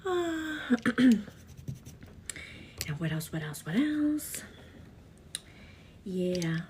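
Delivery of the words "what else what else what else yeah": breathy unvoiced whisper. Real, not acted. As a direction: drop to a whisper. Whispered aside.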